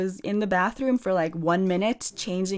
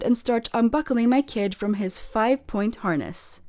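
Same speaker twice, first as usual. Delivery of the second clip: ordinary talk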